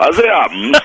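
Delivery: high, put-on Jimmy Savile voice; Jimmy Savile impression. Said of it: "Jimmy Savile".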